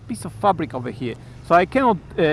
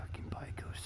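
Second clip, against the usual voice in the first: whisper; 23 dB quieter than usual. Real, not acted. Muttering about cyclists.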